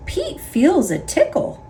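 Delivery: plain reading voice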